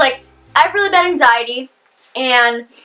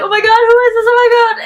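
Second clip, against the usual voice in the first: retold panic, higher and louder; panicked; 10 semitones higher, 4 dB louder, faster than usual. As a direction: relive the fright of a stranger's car pulling up. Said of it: "starting to cry more".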